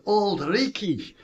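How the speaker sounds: put-on a light Scottish accent on the old name. Give Auld Reekie a hint of Scottish brogue. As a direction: golf coach telling kids Edinburgh's nickname, with a touch of Scottish accent. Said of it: "I would do it with that Scottish accent".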